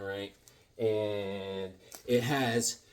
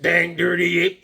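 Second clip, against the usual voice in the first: hammed-up loud dang dirty ape; mock movie growl; 4 semitones higher, 7 dB louder than usual. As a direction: bellow it like the movie hero. Quoting ape movie line.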